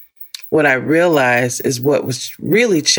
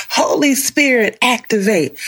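TikTok prayer; 8 semitones higher than usual. Pretend. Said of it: "that thing that, what's on TikTok".

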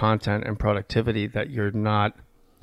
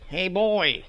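high put-on Yorkie voice; dog voice; 8 semitones higher than usual. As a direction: pitch it up as the little dog. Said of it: "I'll just go like".

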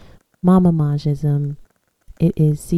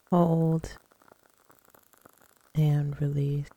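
guided meditation. Acted. Leads guided breathing and affirmations.

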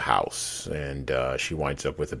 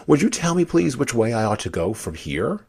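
put-on higher-pitched, politely asking girl; polite Alice; 8 semitones higher, 5 dB louder than usual. A narrator's reading. Alice asking the cat for directions, politely. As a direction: lift the voice into an earnest question.

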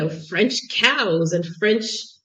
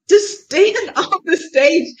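exasperated priest instructing; 8 semitones higher, 5 dB louder, slower than usual. Retold or imitated. Priest corrects her after bowing.

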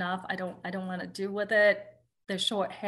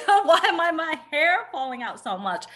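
laughing panic; 8 semitones higher, 6 dB louder than usual. Retold or imitated. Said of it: "and I was like".

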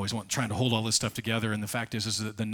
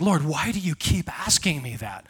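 higher, louder exasperated plea; frustrated disciple, fed up; whiny fed-up question; exasperated Peter; 6 semitones higher, 6 dB louder than usual. Acted. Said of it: "He's like".

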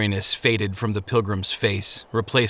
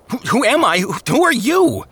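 high, loud startled demand; Faithful demands it back, pitched up and loud; 13 semitones higher, 4 dB louder than usual. A narrator's reading. Faithful at the gate demands who the keeper is, high and loud. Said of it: "Faithful demanded".

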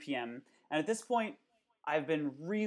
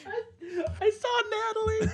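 laughing; 5 dB louder than usual. Real, not acted. Laughing fit after a gasp.

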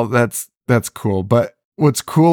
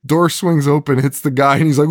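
usual speaking voice

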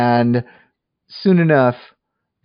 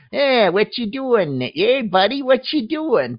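mock a really bad fake American accent, pitched way up; 12 semitones higher, slower than usual. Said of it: "the American accents are just awful".

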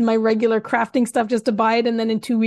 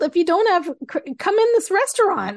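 high-pitched, lively mock invitation; mock welcome; 8 semitones higher than usual. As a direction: wave customers in with a high, animated voice. Voicing the restaurant's welcome to cash payers.

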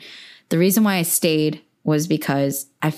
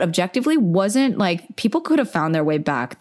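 ordinary delivery

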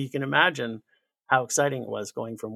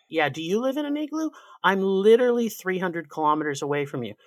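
higher, louder mock sarcasm; sarcastic retort; 6 semitones higher, 5 dB louder than usual. Canadian mocking the Montanan back, sarcastic and higher. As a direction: throw the question back, dry.

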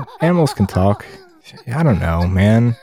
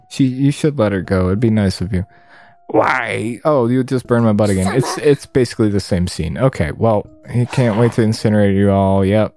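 normal talking voice

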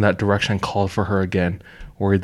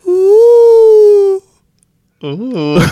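high wailing hoot, stretched out; loon call; 12 dB louder than usual. Shown as a demonstration. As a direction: imitate a haunting loon cry. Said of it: "Do the loon".

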